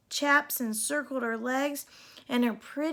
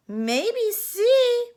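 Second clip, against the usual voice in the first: put-on higher, louder folksy old lady scolding; a tough old gal telling her to shape up; fairy godmother scolding Cindy, higher and louder; scolding cowgirl godmother; 10 semitones higher, 8 dB louder, much slower than usual. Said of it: "said the fairy godmother".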